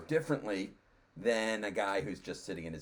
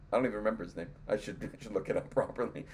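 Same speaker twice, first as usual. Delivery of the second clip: ordinary talk, nothing marked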